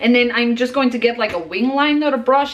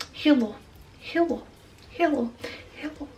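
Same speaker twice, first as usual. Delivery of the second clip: soft repeated hellos; 8 dB quieter than usual. Pretend. Greeting herself in the mirror.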